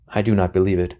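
ordinary delivery

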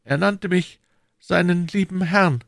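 high, slow musing to himself; talking to himself. A narrator's reading. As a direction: marvel to yourself, high and drawn out. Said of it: "sprach der Krämer zu sich selbst". (langsames, hohes Selbstgespräch)

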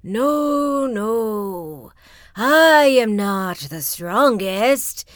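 loud, slow, drawn-out booming wind; slow roaring wind voice; gusty shout. Acted. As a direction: bellow it like a great gust. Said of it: "shouted a great gusty shout".

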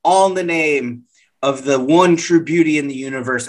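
ordinary delivery

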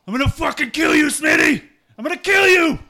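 high screaming rage at Smitty; drained spirit shrieking vengeance; furious threat. Acted. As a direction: scream the threat with full venom.

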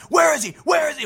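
high, urgent raid shout in retelling; imitated frantic police demand; mock cop yelling. Cops bursting in with guns.

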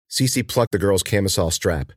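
plain reading voice

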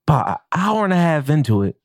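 ordinary talk

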